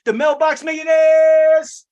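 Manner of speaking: slow, high, loud hype shout of the name; hype announcement. Acted. Coach hyping his webinar crowd, slow loud hype call. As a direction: boom out the brand like a hype man.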